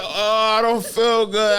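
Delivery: high, slow pained whimper; mock groan. Telling the pain in his stomach, high slow whimper. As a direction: whimper that you feel sick. Retold sick complaint.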